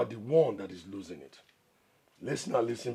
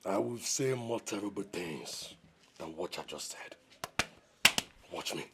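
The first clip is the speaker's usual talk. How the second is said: quiet menace; 4 dB quieter, slower than usual. Acted. Warning his critic of worse to come, quiet menace. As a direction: let the threat sink slowly and softly.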